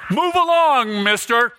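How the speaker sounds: brusque serving lady. Retold or imitated. Chicken lady dismisses the governor.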